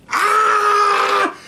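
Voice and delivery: very high, sustained mock shriek; imitated woman's scream. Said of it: "I hear a woman screaming".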